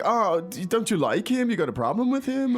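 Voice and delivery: high-pitched, disbelieving, picking a fight; incredulous challenge. Retold or imitated. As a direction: act shocked, like they're asking for trouble. Now, it's like.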